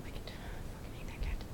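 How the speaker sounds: soft whispered admission; whispered aside. Real, not acted. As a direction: confess it under the breath. Whispered confession about cat.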